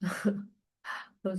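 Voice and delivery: slow, stretched through a yawn. Real, not acted. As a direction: talk through a big yawn. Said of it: "I'm yawning".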